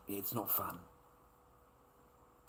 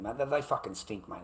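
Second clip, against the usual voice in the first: ordinary talk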